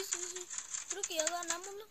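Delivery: ordinary delivery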